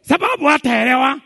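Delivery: loud high punch; loud, high preacher punch; punchline shout. Real, not acted. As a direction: slam the punchline home.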